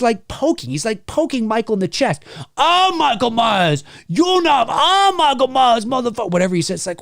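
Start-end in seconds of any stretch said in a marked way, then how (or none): 2.6-6.3 [name]. Acted. Streamer acting out poking Michael Myers, taunting. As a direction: stretch the name out in a hammy, high voice. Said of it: "When [name] is in the outfit".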